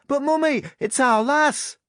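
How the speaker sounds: high, loud little Yorkshire tyke; young boy yelling in a common Yorkshire accent; Yorkshire boy's shout. A narrator's reading. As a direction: protest to mother in broad Yorkshire, drawing out the end. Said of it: "sounding like some vulgar tyke".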